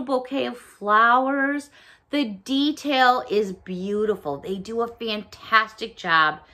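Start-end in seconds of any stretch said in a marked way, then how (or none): none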